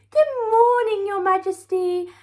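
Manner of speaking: sing-song morning greeting; chirpy maid. Acted. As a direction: chirp it out cheerfully. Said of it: "she chirped".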